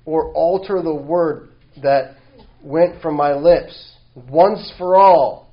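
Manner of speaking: slow, rising to loud on God's oath; emphatic scripture reading. Acted. Preacher reading God's oath to congregation, slow and emphatic. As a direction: read it slowly, building in force.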